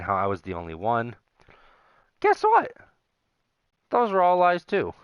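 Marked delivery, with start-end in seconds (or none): 2.2-4.9 shouting up high and loud in bitter mockery; high outburst. Real, not acted. Ranting about his cheating ex, high loud scornful shout.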